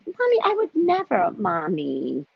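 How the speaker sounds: mock-sweet child denial; feigned innocence. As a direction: play the innocent daughter. Girl feigning innocence to mother.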